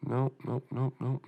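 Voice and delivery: normal talking voice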